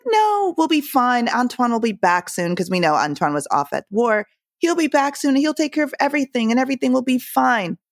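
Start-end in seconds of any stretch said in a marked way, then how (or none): 0.1-2.5 singled-out "no", stretched high, then easing down; dismissive reassurance. Retold or imitated. Voicing sister's dismissive reassurance. 4.6-7.8 high-pitched mock reassurance; lifted, placating voice, imitating denial; naive reassurance. Voicing sister's naive reassurance.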